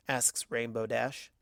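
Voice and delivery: ordinary delivery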